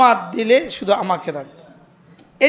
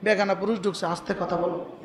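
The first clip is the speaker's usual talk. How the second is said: softer, lower hush to his wife; hushed warning; 4 semitones lower, 12 dB quieter than usual. Retold or imitated. Husband hushing his wife about the thief, softly. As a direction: keep it down, a stranger is inside. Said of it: "তো বলতেছে".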